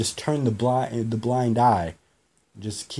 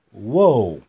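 startled whoa; 3 semitones lower, 7 dB louder than usual. Retold or imitated. Man walks toward him.

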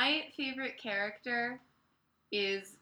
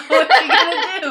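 loud, high laughing echo; 6 semitones higher, 18 dB louder than usual. Real, not acted. Co-host repeating the other's joke back, laughing hard. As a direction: crack up while saying it.

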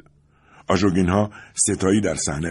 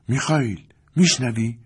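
alarmed; 6 semitones higher than usual. A narrator's reading. Wife alarmed asks husband.

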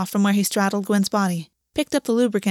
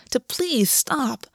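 usual speaking voice

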